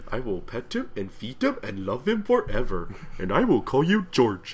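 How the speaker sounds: doting cartoon monster impression; lightly voiced lummox cooing over a new pet; Abominable Snowman. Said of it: "Abominable Snowman in".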